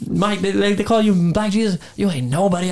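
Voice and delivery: high, mocking trash talk. Rookie taunting Michael Jordan on court, mocking trash talk.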